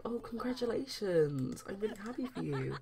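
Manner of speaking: high, drawn-out acted delight; gushing congratulations. [name] congratulating Jamie on his work news, gushing. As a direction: stretch it out high, cooing praise.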